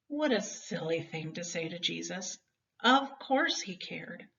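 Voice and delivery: usual speaking voice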